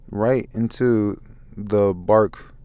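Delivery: ordinary talk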